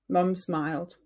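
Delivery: usual speaking voice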